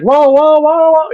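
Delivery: yelping as the scooter hits his ankles; startled whoa. Retold or imitated. Son-in-law yelps as the scooter rolls onto him, high and loud. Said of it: "and he's like".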